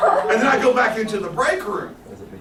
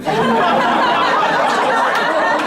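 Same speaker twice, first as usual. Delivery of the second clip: loud high burst of laughter; 9 dB louder than usual. Real, not acted. Guest cracking up after the Hezbollah reveal. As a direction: explode into laughter.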